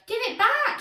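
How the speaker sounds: high, loud schoolboy demand; shouted. A narrator's reading. Pupil demands his pen from classmate, shouting. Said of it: "shouted Inky Ian".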